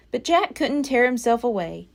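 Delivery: plain reading voice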